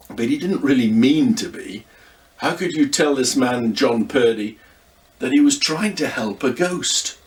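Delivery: usual speaking voice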